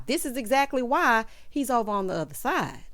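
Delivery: ordinary talk, nothing marked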